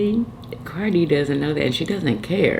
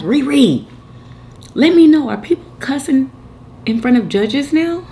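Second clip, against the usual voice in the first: calling out; 9 semitones higher, 4 dB louder than usual. Real, not acted. Calling out to viewer.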